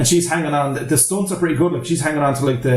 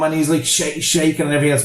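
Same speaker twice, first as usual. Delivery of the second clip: plain reading voice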